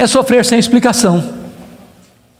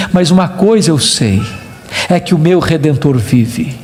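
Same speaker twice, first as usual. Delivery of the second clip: ordinary delivery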